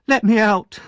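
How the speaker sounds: high, loud furious shout. Acted. Oliver shouts from the locked cellar, high and loud. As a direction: bang on the door and yell. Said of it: "he shouted".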